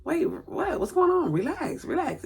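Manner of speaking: husband hearing the news, caught off guard; surprised husband. Retold or imitated. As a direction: pitch up into a baffled what, then soothe. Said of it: "he looking like".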